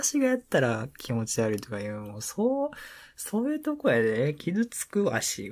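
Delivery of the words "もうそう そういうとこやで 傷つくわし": mock hurt. Mock hurt complaint to girlfriend.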